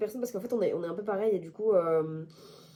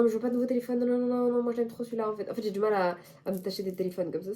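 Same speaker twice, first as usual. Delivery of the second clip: ordinary talk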